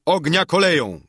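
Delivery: high, ringing military order; shouted command. A narrator's reading. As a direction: call out the firing order to the troops. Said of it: "Krzyknął". (donośna, wykrzyczana komenda)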